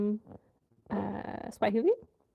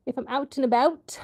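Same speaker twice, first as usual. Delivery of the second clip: Canadian accent; 5 semitones higher, 5 dB louder than usual. Real, not acted. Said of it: "Was that a Canadian accent?".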